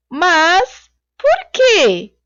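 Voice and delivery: puzzled salesman's slow question. Shown as a demonstration. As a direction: stretch "por quê" into a wondering question. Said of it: "Ponto de interrogação para indicar que o vendedor fez uma pergunta".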